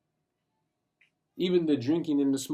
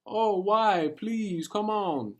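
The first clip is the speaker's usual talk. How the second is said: mock pleading; 8 semitones higher, much slower than usual. Imitating friends begging.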